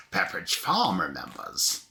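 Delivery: lightly imitating an old TV commercial; Pepperidge Farm ad voice. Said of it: "He's doing the Pepperidge Farm remembrance one".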